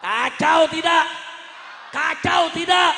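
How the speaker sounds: high, full-force slow shout to a crowd; rallying shout. Real, not acted. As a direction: roar the question until the crowd answers.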